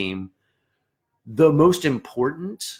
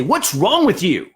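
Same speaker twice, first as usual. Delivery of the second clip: a sharp, higher, louder what's wrong with you; mock angry snap; 8 semitones higher, 5 dB louder, slower than usual. Section leader snapping at a friend, mock angry. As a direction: bark it like a frustrated section leader. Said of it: "tempted to get mad at them and say".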